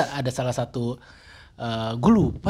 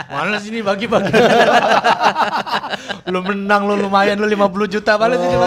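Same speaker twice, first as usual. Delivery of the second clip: higher-pitched mock demand between laughs; joking money-demanding mother; 6 semitones higher, 3 dB louder than usual. Late mother asking winner for a cut, joking demand. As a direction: play a pushy mum, laughing through it. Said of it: "nyokap gue datang terus minta duit".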